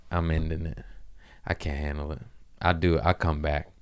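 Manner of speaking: ordinary talk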